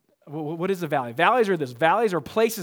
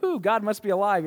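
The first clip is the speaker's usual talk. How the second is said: mock sports cheer; 6 semitones higher, 5 dB louder than usual. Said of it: "you're like".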